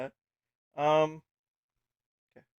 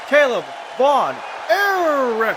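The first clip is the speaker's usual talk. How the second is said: very slow, higher, louder drawn-out name; ring announcer name call; 9 semitones higher, 7 dB louder, much slower than usual. Acted. Ring announcer calling Caleb Von Erich's name to the arena, booming and drawn out.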